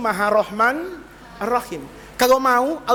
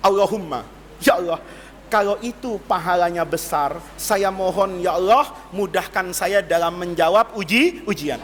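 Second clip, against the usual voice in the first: low humble prayer; 8 semitones lower than usual. Retold or imitated. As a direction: beg God humbly for an easy exam. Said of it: "Dia bilang".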